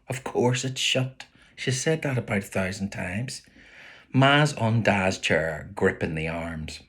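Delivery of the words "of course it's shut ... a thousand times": plain reading voice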